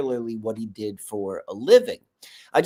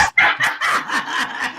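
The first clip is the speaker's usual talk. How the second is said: high breathy laugh; 4 dB louder than usual. Retold or imitated. Laugh in disbelief.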